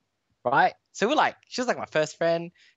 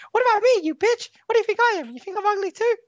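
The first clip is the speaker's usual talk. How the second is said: very high, louder shrieking accusation; hammed-up jealous girlfriend snapping at him; mock angry girlfriend; 3 dB louder than usual. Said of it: "then she'd be like".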